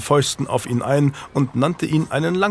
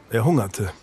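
ordinary delivery, nothing marked

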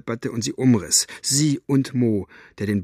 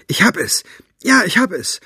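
higher, louder call of success; excited cry; 9 semitones higher, 7 dB louder than usual. A narrator's reading. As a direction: cry out in triumph, rising in excitement. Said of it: "rief Farid".

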